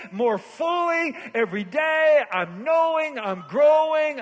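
high, loud, building preacher's cadence; full-force preaching. Real, not acted. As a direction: drive the list home, stretching key words.

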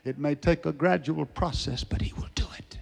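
soft drop to a near whisper; hushed assurance. Real, not acted. Preacher assuring listeners He will do it, hushed. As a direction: bring it right down, almost whispering. Sudden hush after shouted climax.

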